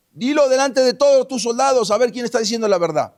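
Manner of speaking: high, loud public challenge; defiant dare. Retold or imitated. David dares Abner before his soldiers, loud defiance. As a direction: dare him to answer in front of everyone.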